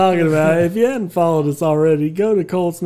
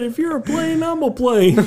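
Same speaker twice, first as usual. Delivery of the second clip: mock swoon; 9 semitones higher than usual. Mocking Cody's plane love.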